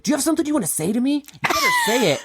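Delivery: hammed-up angry demand; mock furious. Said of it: "He's like".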